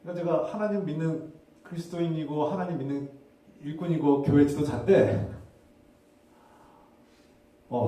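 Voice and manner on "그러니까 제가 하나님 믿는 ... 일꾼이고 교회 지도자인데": ordinary talk, nothing marked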